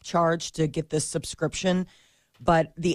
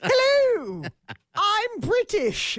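very high, slow mock British accent; British impression; much slower than usual. Host admits her terrible British impression, very high and slow. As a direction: drag it out, a squeaky cartoon Brit. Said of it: "spot on impression".

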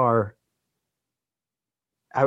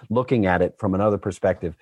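normal talking voice

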